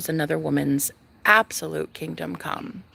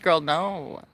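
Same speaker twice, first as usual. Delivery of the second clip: ordinary delivery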